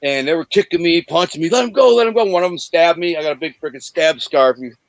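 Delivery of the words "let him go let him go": raised, urgent shout to let him go; yelled plea. Retold or imitated. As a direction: call out over the fight, pleading. Shouting during the street fight.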